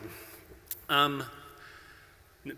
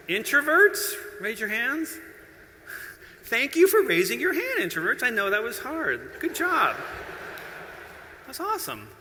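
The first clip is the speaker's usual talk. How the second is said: calling to audience; 9 semitones higher, 3 dB louder than usual. Real, not acted.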